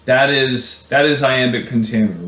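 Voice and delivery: ordinary talk, nothing marked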